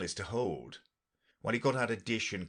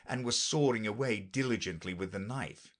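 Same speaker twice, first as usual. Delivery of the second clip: plain reading voice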